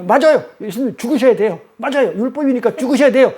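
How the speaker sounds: Peter finally gets it and blurts it out; eager Peter. Acted. Peter voiced, eager realisation. (깨달은 베드로의 들뜬 목소리)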